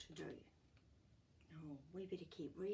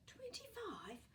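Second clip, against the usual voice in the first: breathless astonished whisper; amazed whisper; 4 dB quieter than usual. Real, not acted. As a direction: whisper it in disbelief. Reader's amazed aside.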